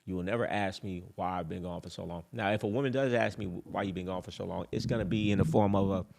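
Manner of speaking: ordinary talk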